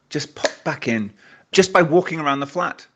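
normal talking voice

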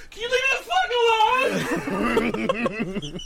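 high, drawn-out mock scream; exasperated yell. Upset wife to pestering husband, exasperated yell. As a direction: imitate her losing it, shrieking slowly. Imitates upset partner snapping at him.

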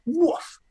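a high sudden woof as the punchline; comic yelp. Retold or imitated. Comedian ending the joke on a high yelp. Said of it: "so then one night I just said".

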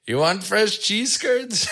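high, slow, light clerk voice; imitating the clerk. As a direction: hawk the curds in a lifted salesman voice. Clerk offering cheese curds, imitated.

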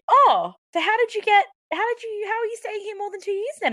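mock high-pitched inquisitive voice; nosy questioner.

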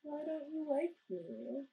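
high, slow, soft little plea; pitiful mock question to a ghost; imitated pleading. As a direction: mimic him asking sweetly, drawn out and rising. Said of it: "you missed Aaron being like".

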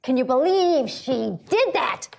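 imitating an appalled neighbor, slowly drawn out; slow, aghast mimicry of a scandalized friend; consternation. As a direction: draw out each word in mock disbelief. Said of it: "looking at me with such consternation".

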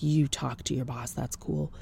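low, soft, deadpan reply; dry dismissal. Retold or imitated. Patient letting the receptionist go ask, dry dismissal. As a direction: drop the voice and feign indifference.

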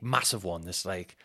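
ordinary delivery

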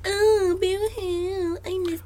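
high, wailing mock sob; whiny crying. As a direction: whimper it out like a homesick kid. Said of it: "She's like".

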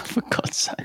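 laughing whisper. Real, not acted. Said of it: "laughing in disbelief".

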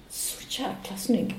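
slow, unvoiced whisper of "snygg"; swooning whisper. Real, not acted. Singer swoons over the handsome guard in a whisper. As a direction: breathe "Så jäkla snygg" like a secret crush.

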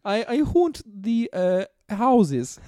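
lightly voiced Soviet dictator with a vague accent; Joseph Stalin. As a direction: play an accented dictator as fashion expert. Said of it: "Joseph Stalin here".

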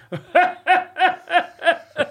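high, wheezing imitated laugh; imitated infectious laugh. Impressionist imitates his uncle's infectious laugh. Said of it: "He used to laugh like this".

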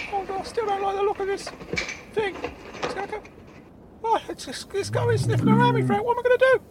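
very high, panicky character voice; Claude. Acted. Claude panics on car roof.

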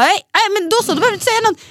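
high, loud quarrelling quote; exasperated, pitch leaping up; heated retold protest. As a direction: act out an indignant partner cutting in.

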